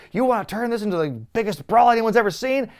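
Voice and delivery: high, loud showman's pitch that rises on brawl; carnival barker. Retold or imitated. The host retells Cena selling the brawl to the fans like a barker. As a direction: sell it to the crowd like a fairground barker. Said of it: "Cena is full-on carnival barker".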